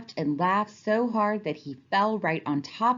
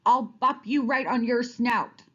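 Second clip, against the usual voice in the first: mock yell; 7 semitones higher, 3 dB louder than usual. A narrator's reading. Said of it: "he yelled".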